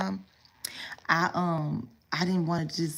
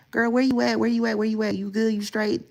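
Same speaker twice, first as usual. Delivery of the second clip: worried roommate; 5 semitones higher, 4 dB louder than usual. Retold or imitated. Roommates calling each other.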